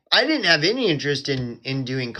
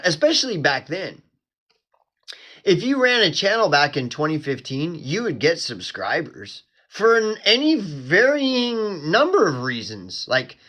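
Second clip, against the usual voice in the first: usual speaking voice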